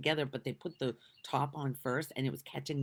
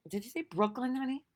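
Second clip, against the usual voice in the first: ordinary talk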